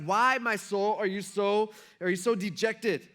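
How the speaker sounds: loud, high, impassioned soul-searching; acted self-questioning. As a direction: question your own soul insistently. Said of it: "like he's talking to himself".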